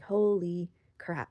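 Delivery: very drawn-out exclamation; stunned realization. Retold or imitated. Woman realizing her biggest lesson, slow stunned exclamation. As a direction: stretch each word slowly in mock amazement.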